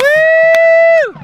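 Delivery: high, loud whoop; cheering. Real, not acted. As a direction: whoop for the heroes. Cheering the forest service float.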